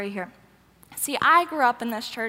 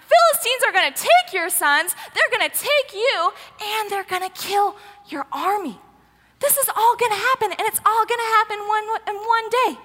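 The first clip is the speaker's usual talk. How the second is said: higher, forceful scolding, acted out; angry rebuke; 7 semitones higher, 4 dB louder than usual. Samuel telling Saul he will die tomorrow, angry rebuke. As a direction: lay into him with emphatic, mounting outrage.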